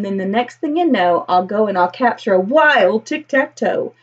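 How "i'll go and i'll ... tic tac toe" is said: ordinary delivery, nothing marked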